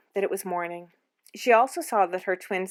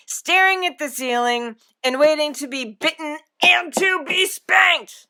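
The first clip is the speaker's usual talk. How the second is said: loud voice; 7 semitones higher, 7 dB louder than usual. A narrator's reading. Father threatening spanking to end the nonsense, loud. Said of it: "he added in a loud voice".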